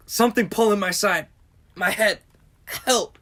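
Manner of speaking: ailing panic. Acted. Quarreler overcome by sudden ailments.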